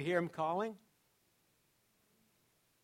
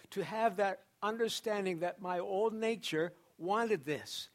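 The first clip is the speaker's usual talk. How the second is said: ordinary talk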